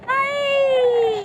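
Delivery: high, piercing shriek; a high, frightened shriek. A narrator's reading. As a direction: shriek in panic in the dark. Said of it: "Shrieked Flap".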